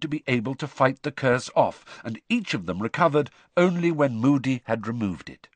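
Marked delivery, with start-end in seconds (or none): none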